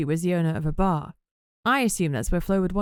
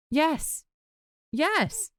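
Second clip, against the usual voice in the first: too enthusiastic; 10 semitones higher than usual. A narrator's reading. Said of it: "perhaps a little too enthusiastically".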